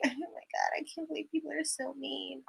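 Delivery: tiny, high, very soft pretend crybaby; mock whimper. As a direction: whimper softly as a wounded contestant, mockingly. Mimicking the contestants who cry.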